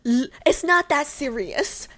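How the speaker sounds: ordinary talk, nothing marked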